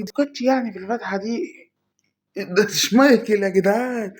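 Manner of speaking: can't believe the luck, shout it; thrilled shouting. Real, not acted. Gamer celebrating a legend pull, high and loud. Schmeichel pulled from pack.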